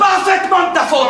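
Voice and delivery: high, insistent indignant retort; angry accusation. Acted. Brother pressing the blame, angry accusation. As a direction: insist hotly, pitch jumping up. Brothers blaming each other.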